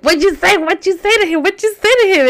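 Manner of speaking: high, loud, rapid-fire repeated question; urgent grilling. Retold or imitated. Daughter grilling mom about the letter, high urgent questioning. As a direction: press for the gossip, voice way up.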